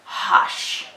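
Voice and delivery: showing the sh in hush. Shown as a demonstration. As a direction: hush ending in a long hushed sh. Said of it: "and we say".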